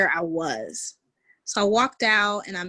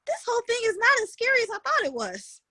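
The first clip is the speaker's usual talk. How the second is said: relieved musing; 8 semitones higher than usual. Retold or imitated. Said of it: "and I go".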